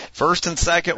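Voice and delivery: lively sportscaster calling the action; play-by-play announcer. Acted. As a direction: call it like a radio broadcaster. Announces the simulated game.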